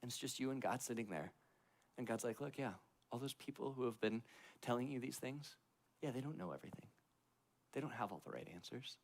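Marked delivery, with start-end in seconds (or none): 2.4-9.0 1 gentle reassuring God. Retold or imitated. God's gentle reassurance, voiced.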